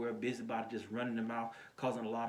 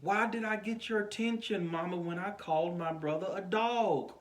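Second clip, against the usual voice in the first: high-pitched mock protest to mama; protesting child; 8 semitones higher than usual. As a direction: act out a child's complaint, pitch held high. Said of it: "I'm like".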